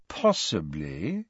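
slow, low, hedging reply; stall, unwilling to commit; drawn-out, cautious evasiveness; noncommittal. A narrator's reading. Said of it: "in a tone that suggested he was unwilling to commit himself".

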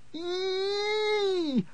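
very high screech as he charges; shrill scream. A narrator's reading. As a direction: let out a piercing attack scream. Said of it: "he screamed and charged".

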